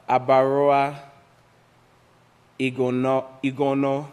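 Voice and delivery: Abaroa Igono sounded out in separate, deliberate pieces; careful name pronunciation. Shown as a demonstration. Teacher reading a doctor's name aloud, pronounced deliberately. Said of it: "you gotta say it like that".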